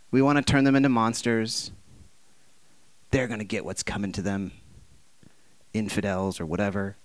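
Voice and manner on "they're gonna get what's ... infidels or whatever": mock vengeful mutter. Mocking vengeful attitude.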